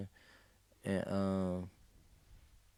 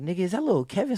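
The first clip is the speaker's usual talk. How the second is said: shocked question; 9 semitones higher, 4 dB louder, faster than usual. Retold or imitated. Recognizing friend on the floor.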